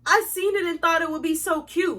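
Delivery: high, sweet put-on innocence; mock boyfriend. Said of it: "make it seem like".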